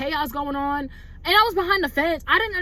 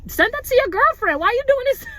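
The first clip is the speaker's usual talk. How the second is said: higher, louder mock outburst at the ex; mock yell; 10 semitones higher, 4 dB louder than usual. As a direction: yell it at him, half laughing at the absurdity. Mock yelling at her ex.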